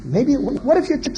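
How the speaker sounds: usual speaking voice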